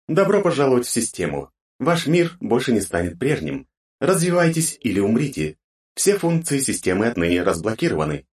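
lifeless robotic system voice; flat robotic announcement. Acted. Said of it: "безжизненным роботизированным голосом".

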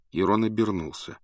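plain reading voice

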